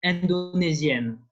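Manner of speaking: "Indonesian" said as French indonésienne, with clear final N; French feminine form. Shown as a demonstration. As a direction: sound the N at the end of "Indonesian", French style. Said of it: "there you pronounce".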